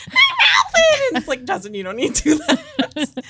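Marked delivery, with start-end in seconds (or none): 0.1-1.1 very high, slow squeaky shout; squeaky chipmunk voice. Retold or imitated. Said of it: "trying to do a chipmunk voice". 1.4-3.3 laughing. Retold or imitated. Laughing reply to the actor.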